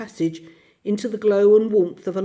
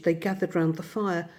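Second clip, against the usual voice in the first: plain reading voice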